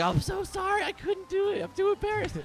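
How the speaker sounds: mock mortified squeal, very high-pitched; melting with embarrassment. Embarrassed streamer apologising for not managing it, hammed-up high squeal. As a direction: imitate someone melting into a puddle of apology. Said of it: "she's like, melting into a puddle".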